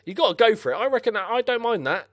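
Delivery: high, loud enthusiastic approval; approving. Real, not acted. Gamer backs his defender's bold lunge.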